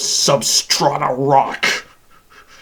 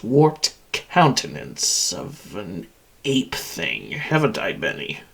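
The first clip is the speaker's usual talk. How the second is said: low menace; 8 semitones lower, 3 dB quieter than usual. Acted. Machine describes captive's deformed face, low gloating. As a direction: sink lower and softer onto the name.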